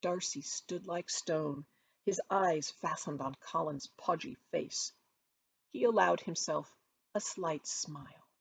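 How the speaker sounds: plain reading voice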